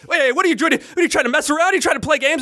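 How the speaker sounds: high-pitched, rattled imitation; agitated. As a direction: mimic a flustered man firing off accusations. Imitating the agitated truck driver.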